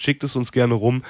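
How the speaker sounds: ordinary delivery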